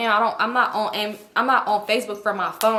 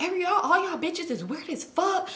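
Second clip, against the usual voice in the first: higher-pitched mock accusation; imitated angry friend; 7 semitones higher, 4 dB quieter than usual. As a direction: imitate a mad friend griping at everyone. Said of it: "Brittany mad as fuck".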